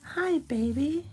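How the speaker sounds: slow, soft and high, cooing hello. Real, not acted. Greeting the little piglet in slow cooing baby talk.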